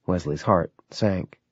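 ordinary delivery, nothing marked

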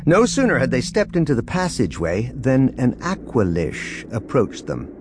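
usual speaking voice

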